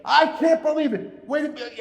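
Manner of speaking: high-pitched mock disbelief; retold cry of a frantic gambler; imitated screaming. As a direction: imitate an overwhelmed bettor on the phone. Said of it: "And Angel's screaming on the other end".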